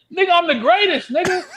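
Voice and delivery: high, loud, laughing brag; fired-up boast. Real, not acted. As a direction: shout your greatness at them.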